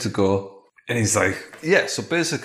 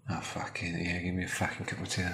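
much softer, sulky swearing under the breath; grumbling mutter; 3 semitones lower, 9 dB quieter, faster than usual. Acted. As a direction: mutter it, fed up and rejected. Rejected husband grumbles.

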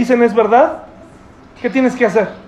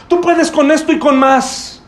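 pushy encouragement; 5 semitones higher than usual. Retold or imitated. Comforter to crying brother, slow emphatic pep talk. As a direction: press it on him, slow and emphatic. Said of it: "Está en pleno llanto".

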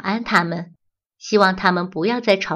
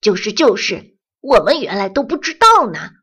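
eager agreement; 6 dB louder than usual. Acted.

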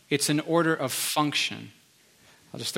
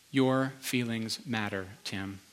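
tender reassurance; 4 semitones lower, 8 dB quieter, slower than usual. Retold or imitated. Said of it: "Pete said one sentence to me".

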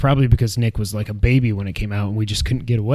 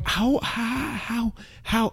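amazed disbelief; 9 semitones higher, 10 dB quieter, much slower than usual. Retold or imitated. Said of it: "and I'm like".

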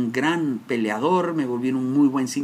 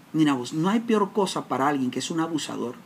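ordinary talk, nothing marked

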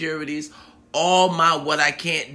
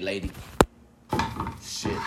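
ordinary talk, nothing marked

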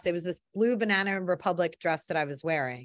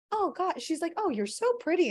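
high, sweet gushing; 9 semitones higher than usual. Retold or imitated. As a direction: gush like a starstruck fan. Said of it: "And then she was like".

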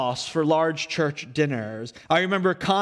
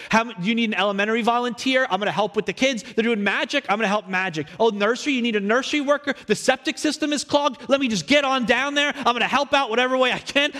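higher and fast, overeager volunteering; eager kid excuses; 5 semitones higher, faster than usual. Retold or imitated. Kid pastor begging to volunteer anywhere, high and rushed. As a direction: rattle off excuses to dodge the service. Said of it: "I'd be like".

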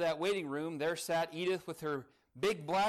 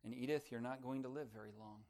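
softer and lower, sorrowful news; heavy-hearted; 7 semitones lower, 9 dB quieter than usual. A narrator's reading. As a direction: deliver the bad news gently and heavily. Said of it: "With a heavy heart".

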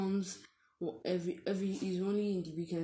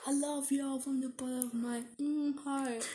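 imam preaching voice, pitched up; lightly voiced imam blessing the crowd; like a preacher; 6 semitones higher than usual. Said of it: "this is my God Imam saying impression".

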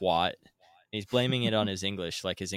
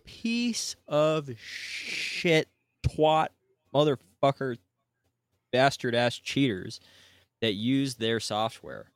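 higher and louder, slow furious insults; angry rant; 5 semitones higher, 5 dB louder, slower than usual. Real, not acted. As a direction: spit each insult out in real outrage. Ranting at the cheaters.

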